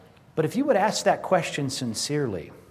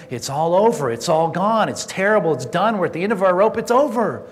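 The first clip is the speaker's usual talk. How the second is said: high, loud outcry building in intensity; despairing finality; 6 semitones higher, 6 dB louder than usual. Acted. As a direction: pile up hammed-up despair, rising to a peak. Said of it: "a sense of finality about it".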